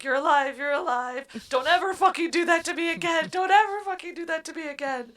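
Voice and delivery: overjoyed and scolding at once; half-laughing emotional relief; loving relief. Retold or imitated. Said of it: "a very loving".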